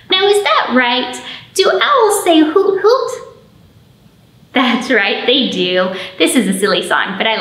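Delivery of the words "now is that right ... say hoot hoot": playful quiz. Acted. Teasing quiz to children.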